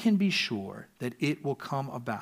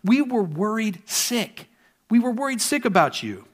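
a touch of a worried mother scolding, higher; lightly voiced Mary, upset and reproachful; mother finding her lost son, mildly scolding; mild exasperated mother; 7 semitones higher, 4 dB louder than usual. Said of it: "Mary says something to the effect".

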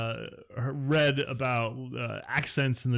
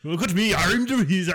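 higher, louder show-off wizard voice; mock wizard; 7 semitones higher, 4 dB louder than usual. Friend joins the bit as a show-off wizard.

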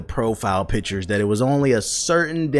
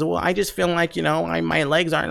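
higher mock voice of a defensive commenter; whiny defender; 7 semitones higher than usual. Mocking a commenter who defends not washing, put-on higher voice. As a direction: imitate the excuse in a put-on whine. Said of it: "people were, like, defending their actions".

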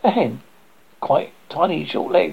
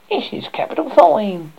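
put-on old woman voice; 7 semitones higher, 4 dB louder than usual.

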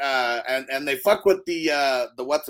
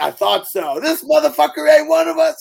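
loud, much higher mock yell; yelling quote; 14 semitones higher, 10 dB louder than usual. Retelling a movie character's triumphant outburst, as a loud high yell. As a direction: bellow it out in triumph.

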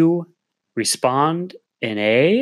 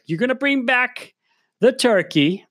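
much higher, louder menacing poodle; threatening dog voice; 9 semitones higher, 4 dB louder than usual. Pretend. Dog Megan threatens Grace over the turkey, in a high menacing voice. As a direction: threaten like a tiny mob boss.

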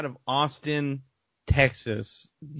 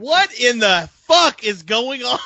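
baffled; 9 semitones higher, 6 dB louder, slower than usual. Real, not acted. Frozen stream gone haywire.

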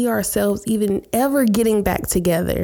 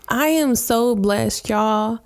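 ordinary talk

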